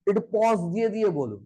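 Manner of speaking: usual speaking voice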